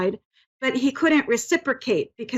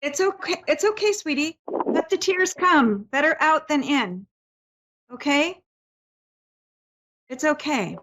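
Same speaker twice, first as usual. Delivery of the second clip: soothing comfort; 4 semitones higher than usual. Real, not acted. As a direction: gently soothe someone who is crying. Medium comforts crying sitter.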